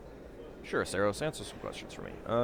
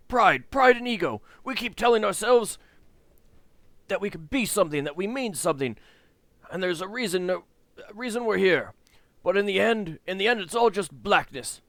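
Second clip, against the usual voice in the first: high, loud bitter lament; despairing old man; 8 semitones higher, 5 dB louder than usual. Acted. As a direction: rant at the pointlessness of it all. Voices despairing Sandoz.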